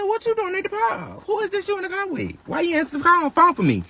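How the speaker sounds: high frantic demand. Retold or imitated. At the park, grilling her man about the car, frantic and high. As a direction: fire the questions at him, frantic.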